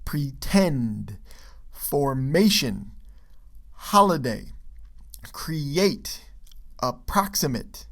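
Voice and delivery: stressed syllable slowed and leaned on; teacher modelling stress in "creATE" and "apPROXimate"; word stress demo. Shown as a demonstration. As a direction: say each word alone, emphasizing its stressed syllable, as in "preTEND, forMAtion, HOliday". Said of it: "do you hear how those underlined parts".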